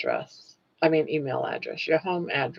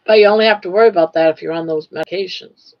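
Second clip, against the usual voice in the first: louder and fast reassurance; rushed reassurance; 2 semitones higher, 9 dB louder, much faster than usual. Real, not acted. Scene: host reassuring listeners after warning, fast and loud. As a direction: hurry to calm the fear.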